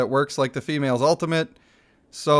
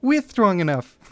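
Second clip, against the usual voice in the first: higher, louder lisp, stubborn; lisping Reza voice; 8 semitones higher, 4 dB louder than usual. Acted. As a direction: insist with a high lisp. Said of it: "get the lisp out".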